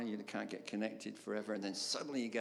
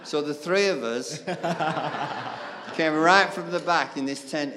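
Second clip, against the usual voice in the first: real laughter breaking through the words, louder; laughing; 5 semitones higher, 11 dB louder than usual. Real, not acted. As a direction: laugh helplessly through the memory. Laughing while recalling embarrassing walk.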